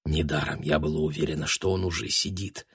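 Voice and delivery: low, soft private thought; inner thought with conviction. A narrator's reading. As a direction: say it under your voice, quite sure. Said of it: "подумала Таня с ещё большей убеждённостью".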